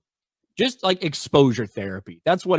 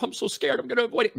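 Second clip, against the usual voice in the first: much higher, timid mocking voice; mock scared whiner; 11 semitones higher, 3 dB quieter, much faster than usual. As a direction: sneer at the scaredy-cat with a high whimper. Mocking scared allergy sufferers.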